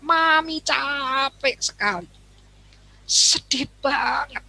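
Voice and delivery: assertive, gentle. Shown as a demonstration. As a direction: tell your child you're tired, tenderly. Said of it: "itu asertif".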